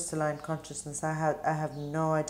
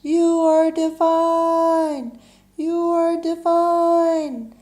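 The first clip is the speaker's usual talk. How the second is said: very high, loud, sustained holler; big slow echoing shout; echo yelled back; 12 semitones higher, 11 dB louder, much slower than usual. Acted. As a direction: yell it back as an echo, long and drawn out. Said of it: "all yell back".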